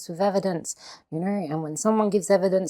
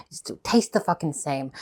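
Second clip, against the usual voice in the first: ordinary talk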